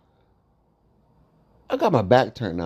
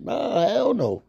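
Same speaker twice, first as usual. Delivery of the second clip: high drawn-out refusal; 12 semitones higher, slower than usual. Acted. Emphatic refusal.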